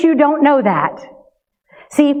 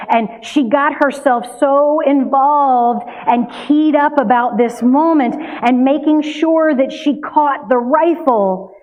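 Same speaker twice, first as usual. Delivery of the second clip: ordinary talk, nothing marked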